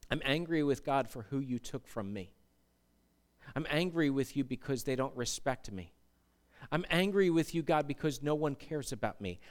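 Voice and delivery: soft, low acted confession to God; subdued anger. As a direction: voice a hurt grievance quietly. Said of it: "might be sitting there in anger today".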